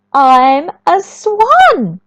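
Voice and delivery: slow, loud and high proclamation; shouted. Acted. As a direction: shout it out with joy. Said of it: "he shouted".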